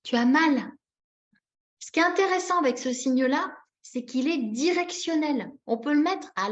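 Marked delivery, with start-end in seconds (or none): 0.0-0.7 acted sympathy. Shown as a demonstration. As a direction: acknowledge a little one's pain kindly. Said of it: "et on fait plutôt".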